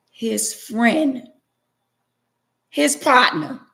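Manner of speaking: "friend" and "partner" pitched high; emphatic repetition. Real, not acted. Wronged wife spelling out to her husband who betrayed him, emphatic.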